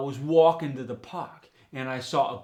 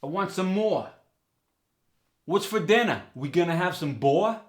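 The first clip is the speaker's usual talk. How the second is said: higher New York voice, MORE and BOAR as "maw-uh", "baw-uh"; New York accent; 4 semitones higher than usual. Shown as a demonstration. Hungry New Yorker asking about dinner, in New York accent. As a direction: ask in New York dialect, or turned to ua. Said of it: "The or sound goes to ua".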